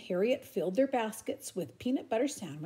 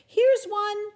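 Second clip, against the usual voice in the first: very high, louder triumphant shout; 13 semitones higher, 9 dB louder than usual. Acted. Chester and Harriet spotting an egg, shouting together. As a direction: yell out the find. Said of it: "shouted Chester and Harriet".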